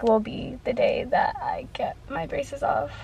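breathless whisper. Real, not acted. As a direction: whisper it like a secret. Excited secret about braces.

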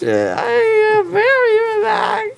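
high teary giggly voice; drugged laughing through tears; loopy laugh-cry. Real, not acted. Drugged patient says relaxed.

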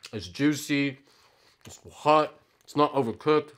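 speaking between bites; talking while chewing. Real, not acted. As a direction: mouth partly full of food. Seen 2: Lips pursed, cheeks working between phrases; burger just eaten, still chewing.

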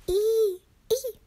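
high-pitched imitated mouse squeaks. A narrator's reading. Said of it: "she squeaked softly".

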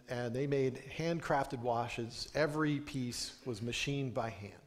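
ordinary talk, nothing marked

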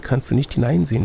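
usual speaking voice

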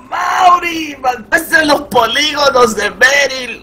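playful outraged shout. Real, not acted. Teasing friend for killing Meryl.

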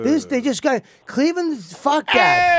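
fuming and shouting about Cleveland winning; angry rant. Real, not acted. Fan shouting angrily that Cleveland just won.

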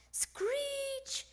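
high, drawn-out squeal of a sax; saxophone screech. A narrator's reading. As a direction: screech it like a badly blown horn. Imitating saxophone noises.

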